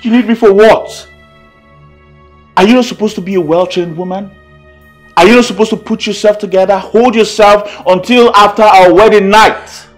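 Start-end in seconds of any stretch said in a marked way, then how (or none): 5.2-9.9 loud, high, deliberate reprimand; angry scolding. Acted. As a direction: lecture your bride at full voice. Husband scolds his bride.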